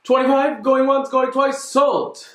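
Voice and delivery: high, lively auction call; auctioneer. Acted. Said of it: "An auction".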